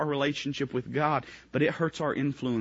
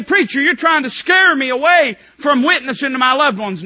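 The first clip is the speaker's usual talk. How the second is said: higher, louder, building preacher's shout; full-force preaching; 11 semitones higher, 11 dB louder than usual. Acted. Preacher voicing a believer calling to family, building shout. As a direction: build it up, calling out each relative.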